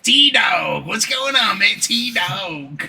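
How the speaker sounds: high, loud, hyped bro greeting; mock Sam greeting. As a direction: greet him like an overeager coworker. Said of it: "be like".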